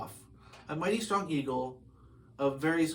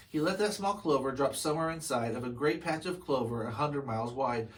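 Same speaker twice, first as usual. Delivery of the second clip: ordinary delivery, nothing marked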